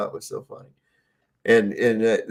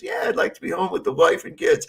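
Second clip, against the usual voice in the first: high silly soldier; 13 semitones higher, faster than usual. Retold or imitated. Soldier answers the sergeant.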